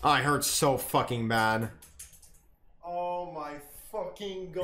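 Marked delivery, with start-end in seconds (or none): 2.8-4.6 very soft, worn-out muttering; hushed, drained disbelief; pained quiet groan. Real, not acted. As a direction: say it under your breath, hurting. Groaning that it hurts.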